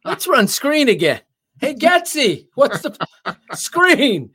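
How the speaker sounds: high, loud mock coach call; mocking play caller. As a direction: squeal it like a frantic coach.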